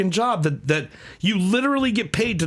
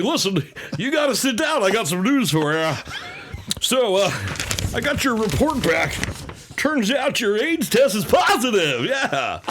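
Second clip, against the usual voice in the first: announcer-voiced doctor; 4 semitones higher than usual. Doctor giving a patient test results, mock announcer voice. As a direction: break bad news like a game call. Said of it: "imagine they're a doctor".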